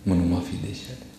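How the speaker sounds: a hushed, retold weeping sorry; tearful plea. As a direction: beg forgiveness, near tears. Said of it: "رو کر کہنے لگا".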